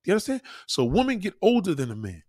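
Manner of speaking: ordinary talk, nothing marked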